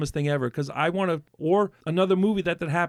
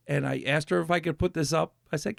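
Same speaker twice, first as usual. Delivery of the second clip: normal talking voice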